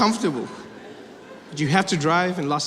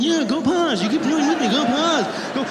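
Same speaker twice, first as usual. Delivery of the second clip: higher-pitched exasperated retort; mock exasperation; 8 semitones higher, slower than usual. Driver to police car behind, mock exasperated. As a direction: act out a fed-up driver waving someone on.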